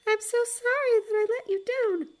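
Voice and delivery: high, small bird voice full of shame; ashamed raven. Acted. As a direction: squeak out the apology. Raven apologizes to her master.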